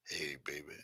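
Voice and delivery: low, soft, smooth Shaft-style pickup line; Shaft accent. Retold or imitated. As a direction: purr it like a seventies movie detective. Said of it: "this Shaft accent".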